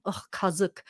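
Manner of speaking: quiet, disgusted ugh, kazık; eye-rolling disgust. Shown as a demonstration. Showing viewers how to say it, eye-rolling disgust. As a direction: roll your eyes and sneer kazık. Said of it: "You roll your eyes at the same time".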